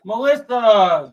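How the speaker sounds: drawn-out slow call. Real, not acted. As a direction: call her name slowly across. Calling out to Melissa.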